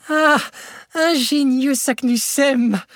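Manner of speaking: high, slow, exultant cry; ecstatic cry. A narrator's reading. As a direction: shout his discovery to the sky. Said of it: "s'écria-t-il". (cri exalté, aigu et lent)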